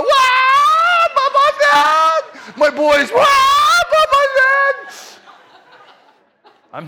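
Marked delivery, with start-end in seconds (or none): 0.0-2.2 very high, loud crying shriek; mock wailing shriek. Daughters wail on learning the bunny died, high crying shriek. 3.1-5.2 very high, loud crying shriek; mock wailing shriek. Daughters wail on learning the bunny died, high crying shriek.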